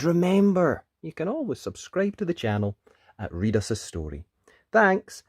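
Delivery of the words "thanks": high, loud sing-song goodbye; cheery bye. Real, not acted. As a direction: wave them off brightly. Cheery sign-off.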